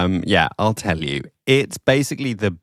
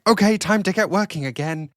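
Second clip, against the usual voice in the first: eager brain voice; 10 semitones higher than usual. Retold or imitated. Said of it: "my brain is like".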